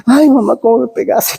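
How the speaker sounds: high-pitched mock whimper; hurt child. Pepito complains to mamá after the smack, mock tearful. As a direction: imitate a smacked boy complaining. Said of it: "Pepito sintió el dolor".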